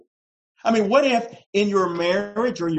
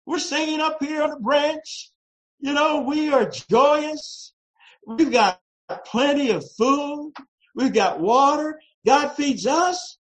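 happy bird voice; 8 semitones higher than usual. Retold or imitated. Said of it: "She said".

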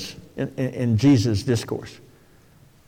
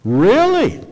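mock surprise; 8 semitones higher, 3 dB louder than usual. Preacher feigns disbelief to congregation.

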